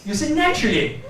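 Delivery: ordinary talk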